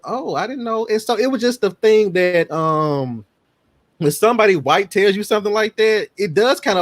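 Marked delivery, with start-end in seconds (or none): 0.0-0.9 high, soft dawning surprise; high surprised kid voice. Retold or imitated. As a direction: squeak it out, caught off guard. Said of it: "I was like".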